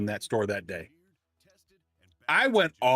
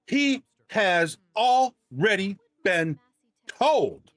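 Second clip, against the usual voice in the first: slow, high, word-by-word condescension; mock a snooty rebuke, pitched up and drawn out; condescending tone; 6 semitones higher, much slower than usual. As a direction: talk down to them, spacing out each word. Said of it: "In that tone of voice".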